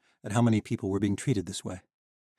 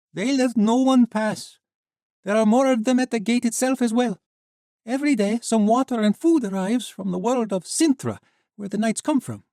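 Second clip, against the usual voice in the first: put-on high, light young woman; 8 dB louder than usual. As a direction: voice her bright and girlish. Sheva explains the gate.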